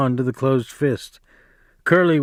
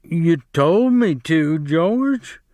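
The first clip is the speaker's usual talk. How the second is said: higher, slow, pleading misery; miserable; 4 semitones higher, much slower than usual. Acted. Lennie cowering against the wall, miserable high plea to George. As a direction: say it like a scolded child. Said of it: "he said miserably".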